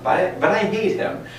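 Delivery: high, whiny sulking king voice; whiny King Ahab. Retold or imitated. Teacher mimics Ahab's petulant complaint, high and whiny. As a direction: pout it out like a sulky king. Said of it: "I remember hearing this".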